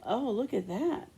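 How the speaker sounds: usual speaking voice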